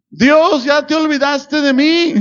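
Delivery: high-pitched mock complaint to God; queja a Dios. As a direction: imitate a believer whining that God forgot them. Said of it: "cualquiera que diga". (queja fingida en tono agudo)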